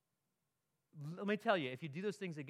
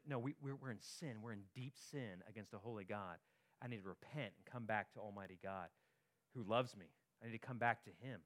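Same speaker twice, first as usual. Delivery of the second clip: hushed confession; 8 semitones lower, 9 dB quieter than usual. Acted. People voiced confessing their sin.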